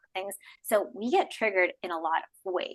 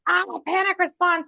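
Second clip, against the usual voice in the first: mock panic; 10 semitones higher, 6 dB louder than usual. Acting panic.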